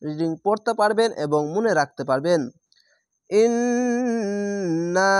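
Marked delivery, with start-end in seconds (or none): 3.3-5.1 slow drawn-out chanted ইন্না with a long held নন; Quran recitation. Shown as a demonstration. Teacher recites ইন্না to show wajib ghunna, slow chant. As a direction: recite ইন্না slowly, holding the nasal. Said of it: "এখানে ওয়াজিব গুণনা".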